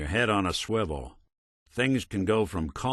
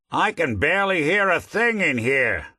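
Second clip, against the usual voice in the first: loud, raised-pitch exclamation; calling out; 8 semitones higher, 5 dB louder than usual. Acted. Detective complaining they can barely hear, calling out. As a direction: call out over a din.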